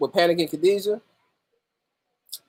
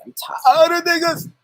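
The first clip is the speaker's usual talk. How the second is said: mock exasperation; 11 semitones higher than usual. Mock complaint.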